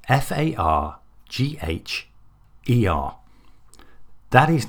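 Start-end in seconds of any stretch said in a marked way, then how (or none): 0.1-3.2 Fargher spelled letter by letter, F A R G H E R, each letter spoken separately; spelling out letters. Shown as a demonstration. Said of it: "maiden name was Fargher".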